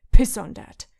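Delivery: loud angry curse; angry outburst. Acted. Drunk Hound scorning Sansa's politeness, loud curse. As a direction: spit the words out loud.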